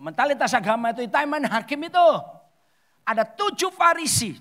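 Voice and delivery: high, loud declamation; forceful preaching. Real, not acted. Preacher denouncing religious mentality to the congregation, loud and forceful. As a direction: proclaim it at full voice.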